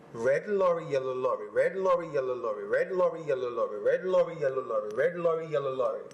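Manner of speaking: sing-song drill rhythm; tongue twister drill. Shown as a demonstration. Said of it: "All right, your turn".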